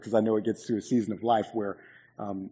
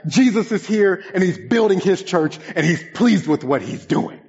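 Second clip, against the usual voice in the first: higher and louder, joyful; imitating Andy; 8 semitones higher, 6 dB louder than usual. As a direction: bubble over like a happy friend. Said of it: "he'd be like".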